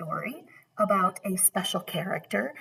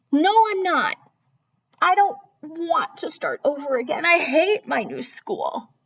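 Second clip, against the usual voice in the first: put-on high, insistent kid complaint; child's protest; 10 semitones higher, 4 dB louder than usual. Sarah arguing with her father about school, high whiny protest. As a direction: protest loudly in a raised child's pitch.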